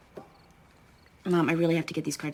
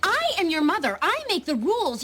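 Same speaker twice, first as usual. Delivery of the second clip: raised, stern mother voice; scolding; 10 semitones higher than usual. Acted. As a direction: lay down the law firmly.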